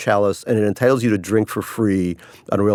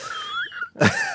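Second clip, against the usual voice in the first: squeaky laugh; 7 dB quieter than usual. Real, not acted. Laughs at own joke.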